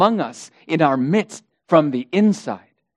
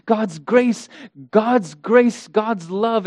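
higher, sing-song mock repetition; mimicking false teachers; 5 semitones higher, slower than usual. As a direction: parrot their pet phrases mockingly. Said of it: "they're always talking about the grace".

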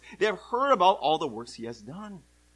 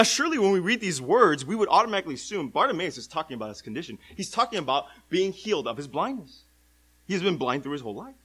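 normal talking voice

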